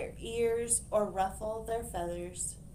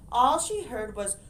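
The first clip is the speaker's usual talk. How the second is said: ordinary delivery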